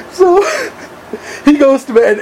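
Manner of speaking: high voice, laughing through the words. Real, not acted. Storyteller moving to the bedtime part, still laughing. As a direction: carry on telling while cracking up.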